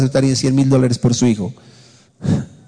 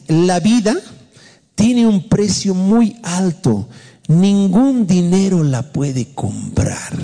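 slow, raised-pitch declamation; scripture reading; 5 semitones higher, slower than usual. Acted. Pastor reading the Psalm to the congregation, slow declamation. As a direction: read it out solemnly, drawing out each phrase. Reads the Bible verse aloud.

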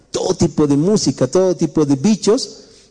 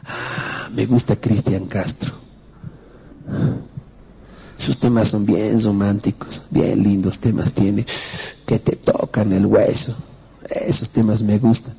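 lower, softer, slow dreamy voice, lightly put on; lovesick youth; 7 semitones lower, 3 dB quieter than usual. A preacher voices a youth swooning over romantic songs, low, soft and slow. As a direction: sigh it out like a smitten boy.

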